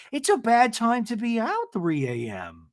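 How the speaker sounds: high imitated warning; mock Gina. Host mimicking Gina's warning about late nights, high-pitched. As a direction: say it high, like a know-it-all. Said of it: "And Gina's like".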